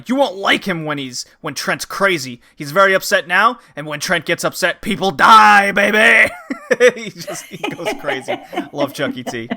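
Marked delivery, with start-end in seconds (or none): 0.1-6.3 building to a loud shout; hyped warning. Retold or imitated. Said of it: "Chucky T exacerbates it by saying". 6.4-6.9 burst of laughter. Real, not acted. Laughs at his own impression.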